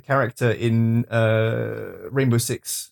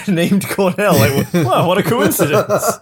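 laughing; 6 semitones higher, 5 dB louder, slower than usual. Real, not acted. Mocking the name coincidence.

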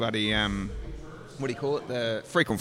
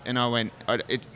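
ordinary talk

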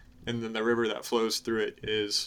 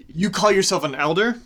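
higher, louder scornful outrage; angry Cyanidel; 5 semitones higher, 8 dB louder than usual. Acted. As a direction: scorn her claim to be an elder. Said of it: "now, he says".

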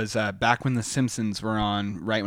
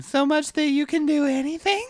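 put-on very high kid voice; child voice; 4 dB louder than usual. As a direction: say it like an excited little kid. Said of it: "say it in a childlike voice".

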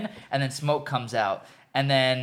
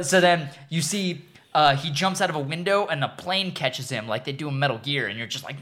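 normal talking voice

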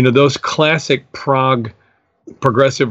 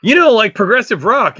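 imitating his friend Dan; 10 semitones higher than usual. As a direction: explain it to the clueless newcomer. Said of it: "and he goes".

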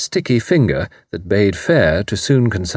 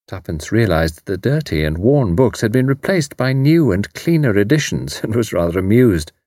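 ordinary delivery, nothing marked

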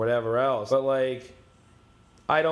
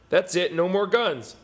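quoting the government; 6 semitones higher, 3 dB louder than usual. Retold or imitated. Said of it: "I'm not gonna do an Australian accent".

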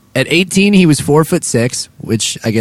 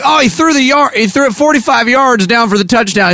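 sportscaster voice; 10 semitones higher than usual. Acted. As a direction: call it like an excited football announcer. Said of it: "it's like".

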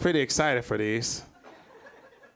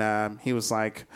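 normal talking voice

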